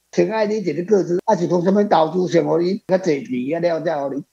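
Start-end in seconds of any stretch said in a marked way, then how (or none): none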